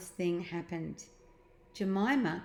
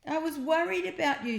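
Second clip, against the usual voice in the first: higher, relieved sister; Jemima, relieved; 10 semitones higher, 3 dB louder than usual. A narrator's reading. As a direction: glad to find him. Jemima voiced.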